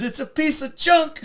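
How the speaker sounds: slow, high, loud retold retort; dismissive outburst. Mechanic condemning Oddball's tank, loudly scornful. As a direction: land it as a loud comic punchline.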